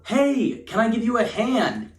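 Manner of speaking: yelling out. Retold or imitated. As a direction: shout the offer over to the stranger. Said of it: "he yells out".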